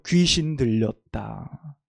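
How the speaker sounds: slow, deliberate "귀신 들렸다"; slow, weighty quoted accusation; slow quote. Acted. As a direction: draw out the accusation slowly. Quoting the crowd's accusation.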